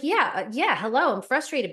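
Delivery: acted-out "well, duh" frustration; exasperated. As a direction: toss it off as glaringly obvious. Acting out obvious frustration to herself.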